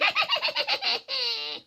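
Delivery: high wicked witch cackle. Acted. Witch gloating by her oven, high evil cackle. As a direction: cackle with glee, high and far. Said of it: "heard her cackle".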